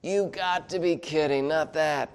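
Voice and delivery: put-on exasperated griping; sarcastic complaining. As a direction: act out a disbelieving grumble. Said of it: "in a tone of sarcasm and complaining".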